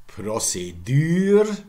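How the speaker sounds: "procédure" as pro-say-DÜR; slow "procédure", loud final syllable; French u in "procédure"; French pronunciation. Shown as a demonstration. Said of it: "something like this in French".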